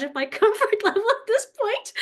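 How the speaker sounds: real laugh rising into the voice; amused laughing. Real, not acted. Joking to the group about dancing naked in the snow, laughing.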